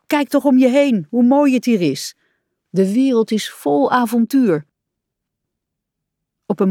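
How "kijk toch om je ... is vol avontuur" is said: raised, lively exclamations; eager and pitched up; enthusiastic urging. A narrator's reading. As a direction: win a friend over to adventure. Rat urging Frog to admire journey.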